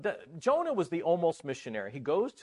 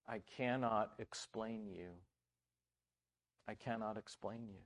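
quiet lament to God; 5 semitones lower, 14 dB quieter than usual. Retold or imitated. Preacher retelling his prayer in the car, hushed lament. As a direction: speak it low, almost to yourself. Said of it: "and I said to the Lord".

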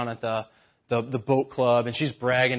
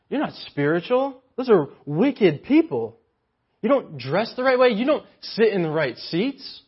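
judgmental mock scold; 6 semitones higher, 4 dB louder than usual. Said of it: "this came up".

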